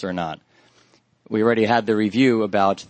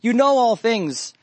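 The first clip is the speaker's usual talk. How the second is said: high, slow, drawn-out plea; heart cry; 11 semitones higher than usual. Acted. Preacher re-voices Peter's answer to the Lord as a heart cry. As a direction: plead it slowly, pitched well up. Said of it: "heart cry that's coming from Peter".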